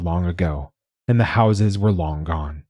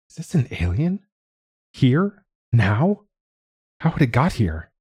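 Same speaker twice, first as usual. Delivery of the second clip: astonished; 5 semitones higher than usual. A narrator's reading. Curator stunned by alien arrival.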